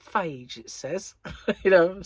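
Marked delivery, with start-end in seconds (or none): 0.0-0.6 Phage said like "fayj", rhyming with cage; advert's pronunciation. Retold or imitated. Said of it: "they're saying that F A G E is pronounced".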